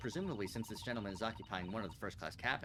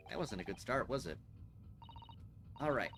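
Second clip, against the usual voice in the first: ordinary talk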